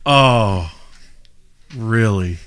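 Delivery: low, quiet, deflated imitation; mock dejection. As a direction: act out weary resignation. Imitates the deflated actor's reaction.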